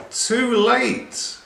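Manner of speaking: high, lively exclamation; exclaimed. Acted. Aladdin as the genie appears, a high exclamation. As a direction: blurt it out as the genie bursts free. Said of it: "exclaimed Aladdin".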